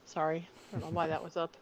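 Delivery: ordinary talk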